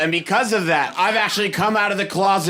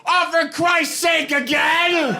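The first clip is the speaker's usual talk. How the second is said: loud high exasperated shout, slow; exasperated uncle; 11 semitones higher, 4 dB louder, slower than usual. Retold or imitated. Uncle to the comedian at Thanksgiving, exasperated shout. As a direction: groan it out, fed up. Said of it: "my uncle goes".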